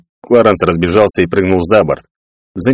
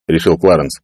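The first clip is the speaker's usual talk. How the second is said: usual speaking voice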